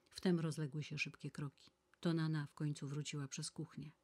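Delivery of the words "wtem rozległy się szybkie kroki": ordinary delivery, nothing marked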